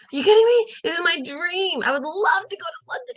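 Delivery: high, loud, giddy disbelief; excited gushing over a London trip; thrilled squeal. Retold or imitated. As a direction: gush like it's a dream come true.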